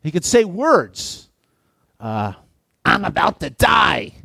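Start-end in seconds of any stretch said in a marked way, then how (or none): 2.8-4.1 high, strained dying snake voice; a feeble croaking serpent. A narrator's reading. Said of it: "croaked the snake".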